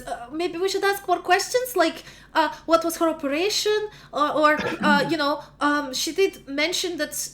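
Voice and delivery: high, hesitant, nervous voice; nervous pleading. Acted. Luba nervously urges the court to ask questions. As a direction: plead timidly, full of ums.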